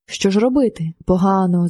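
ordinary delivery

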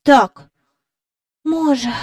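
put-on a child's sighing reply; soft sigh. A narrator's reading. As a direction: let it fall away with a small sigh. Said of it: "тихенько зітхнувши".

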